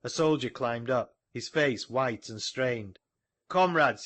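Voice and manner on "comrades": raised, ringing call to the crowd; cried out. A narrator's reading. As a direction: call out over a hall. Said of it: "he cried".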